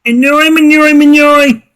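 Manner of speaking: put-on high, garbled old man voice; mumbling old man. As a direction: mumble through missing teeth. Garbled old priest speech.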